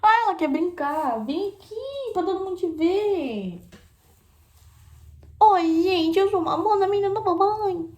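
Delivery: high, loud puppy-talk with a laugh. Real, not acted. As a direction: gush at the dog as her mommy. Voicing and cooing to puppy.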